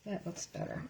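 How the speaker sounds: unvoiced whisper to herself. Real, not acted. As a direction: whisper it under your breath. Quiet remark while lining up.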